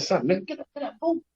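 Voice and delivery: playful get up, boo. Retold or imitated. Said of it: "he said".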